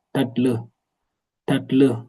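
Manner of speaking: normal talking voice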